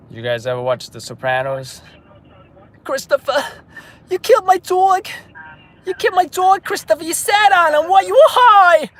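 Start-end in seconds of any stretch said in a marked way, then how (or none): 2.8-8.9 very high, loud wailing New York Italian impression; New York Italian accent. Streamer imitating a Sopranos actress accusing Christopher, shrill New York Italian accent. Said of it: "slash Italian accent? Drea D'Amato from The Sopranos".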